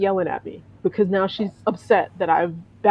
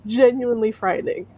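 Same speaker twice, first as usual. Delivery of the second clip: ordinary talk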